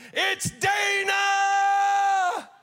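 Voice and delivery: a high, drawn-out, loud announcing shout; joyful shout. Retold or imitated. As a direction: call the name out over the whole tent. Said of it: "he shouted".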